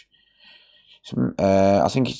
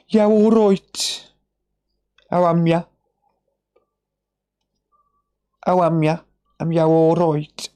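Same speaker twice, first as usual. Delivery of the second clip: Dudley accent greeting; 7 semitones higher than usual. Shown as a demonstration. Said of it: "that's a Dudley accent".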